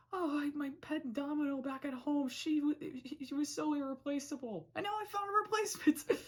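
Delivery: high-pitched, over-excited mock Anne; Anne impression. Imitating Anne showing off a replacement for the pet, high and gushing. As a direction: put on a high, gushing voice, rushing at the end. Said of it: "like, Anne's like".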